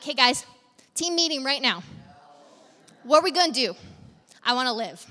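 hammed-up emergency team huddle; loud, lightly comic plea to followers; mock urgent rallying. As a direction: play at rallying the troops, loudly. Imagines rallying followers to survive.

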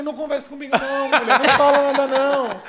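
loud, laughing plea. Retold or imitated. Star-struck fan pleading with [name], laughing loudly. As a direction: beg her to stop through laughter.

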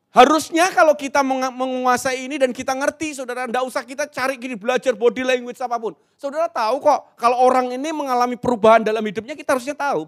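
high, loud rallying delivery; seminar berapi-api. Real, not acted. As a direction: drive the point home at full force to the hall. (berseru berapi-api dengan suara tinggi lantang)

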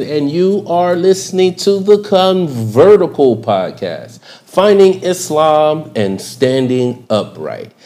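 rising, rhythmic announcer intro; hype host intro. Acted. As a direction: kick off the show like an MC. Rhyming podcast host intro.

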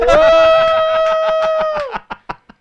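long, high-pitched howl of laughter; real whooping laughter, far above speaking pitch; loud laughing shriek. Real, not acted. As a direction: explode into a very loud, sustained laugh. Friends crack up at impression.

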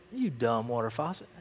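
low, soft aside of mock exasperation; deadpan low put-down; muttered scolding. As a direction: grumble at the faucet under your breath. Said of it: "he talked to that water faucet".

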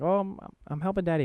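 imitating his son answering; little kid voice. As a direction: speak like a small child. Voicing his toddler son.

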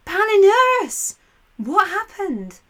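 loud, high, drawn-out call of a friend's name. Acted. Aeneas calling out to Palinurus's ghost, loud and high. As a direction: shout across to a lost friend. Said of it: "Aeneas called out".

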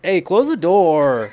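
high, loud, slow whine with a laugh; whiny Korean intonation. Retold or imitated. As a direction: whine it like a little kid, laughing. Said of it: "super whiny".

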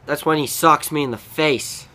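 ordinary delivery

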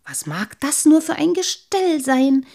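puzzled thought, high and loud; curious little water sprite thinking; wondering. A narrator's reading. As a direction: wonder eagerly to yourself. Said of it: "ging es ihm durch den Kopf".

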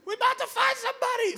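high-pitched mock panic; panicked call. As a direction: call back in hammed-up alarm, voice way up. Shouting back to wife in emergency.

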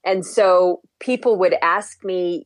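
ordinary talk

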